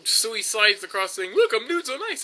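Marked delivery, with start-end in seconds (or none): 1.3-2.2 high-pitched, laughing mock quote; cartoon character impression. Gamer quoting a cartoon gag, high laughing impression. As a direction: imitate a cartoon character sliding past, pitched up. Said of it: "it feels like that episode of Family Guy".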